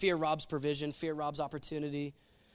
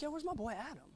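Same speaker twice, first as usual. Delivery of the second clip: a laid-back, offhand "yo" with a softer, different voice; casual God; 8 semitones higher, 9 dB quieter than usual. Retold or imitated. Preacher voicing God strolling the garden, casual and quiet. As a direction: call out like a buddy looking for a friend. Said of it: "And he's like".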